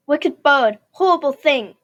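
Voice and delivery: loud furious shout at the bird; crying out in anger. Acted. Man furious at the raven, shouting. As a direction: hurl the insults at the raven. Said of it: "he cries".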